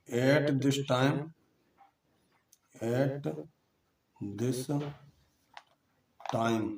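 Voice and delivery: drawn out word by word with long pauses; teacher dictating slowly for students to write down; slow dictation. Shown as a demonstration. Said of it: "T I M E".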